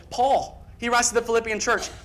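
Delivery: ordinary talk, nothing marked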